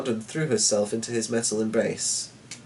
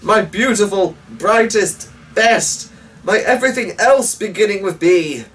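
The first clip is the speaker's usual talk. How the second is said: loud and high, pleading; urgent loving cry; 9 semitones higher, 7 dB louder than usual. A narrator's reading. Knight crying out to his daughter, loud and pleading. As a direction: call out to her with fierce love.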